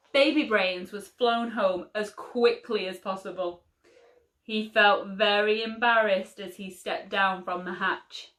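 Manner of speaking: ordinary delivery, nothing marked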